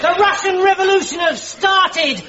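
high, proclaimed breaking news; excited announcement. Acted. Soldier brings officers news from Russia, excited announcement. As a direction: call it out with urgency.

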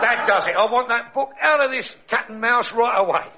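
lightly voiced Cockney pearly king, grumbling; a hint of a Cockney king moaning about his alarm clock. Pearly king grumbling to his wife Else, Cockney. Bert exasperated.

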